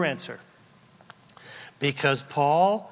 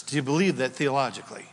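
normal talking voice

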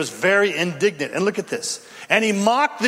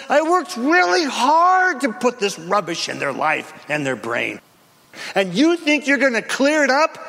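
sneering devil, higher; devil's taunt; 6 semitones higher than usual. Retold or imitated. Devil mocking a believer, higher gloating taunt. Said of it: "That's what the devil says".

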